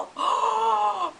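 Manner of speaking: high offended gasp; cartoon gasp. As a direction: gasp like an insulted cat. Cat gasps in cartoon fight.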